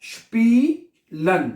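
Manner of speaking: स्पीलन drawn out very slowly, "shpee-len"; slow syllable-by-syllable demo. Shown as a demonstration. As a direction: break स्पीलन into parts like a teacher. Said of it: "तो अब ये क्या बनेगा?".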